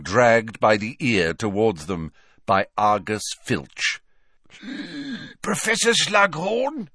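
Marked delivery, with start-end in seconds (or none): 4.6-5.4 high breathless gasp; wheezing gasp. A narrator's reading. Filch arriving winded before Slughorn, a high gasp. 5.4-6.9 higher, wheezy call; breathless caretaker; wheezing Filch. A narrator's reading. Filch wheezing at Slughorn, higher and breathless. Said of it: "wheezed Filch".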